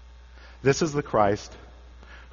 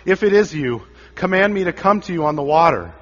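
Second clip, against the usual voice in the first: called loud across the water, higher; yelling out; 5 semitones higher, 6 dB louder than usual. Acted. Peter calling to Jesus over the waves, yelling. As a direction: shout it over the storm. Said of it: "yells out".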